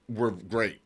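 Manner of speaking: ordinary talk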